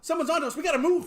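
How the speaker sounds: high, loud call to move; urgent shout. Acted. Player warns the pirates someone's attacking, shouting. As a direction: raise the alarm, urgent.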